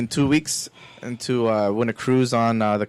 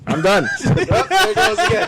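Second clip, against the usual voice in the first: laughing; 3 dB louder than usual. Real, not acted. Camera keeps falling, laughing.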